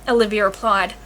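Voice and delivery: plain reading voice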